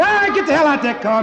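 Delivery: loud, high barking order; mock angry shout. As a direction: yell him out of the car. Acted confrontation at the car.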